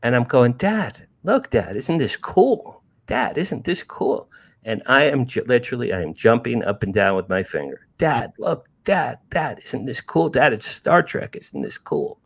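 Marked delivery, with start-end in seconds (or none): 0.5-4.3 put-on excited kid. Boy pointing at UFO. 8.0-12.2 put-on excited kid. Boy jumping up and down.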